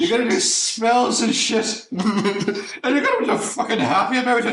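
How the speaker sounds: high parody voice, laughing; hammed-up high impression breaking into laughter; Hagrid. Hagrid pushing Harry into spells, in a high laughing parody voice. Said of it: "and Hagrid is just, like".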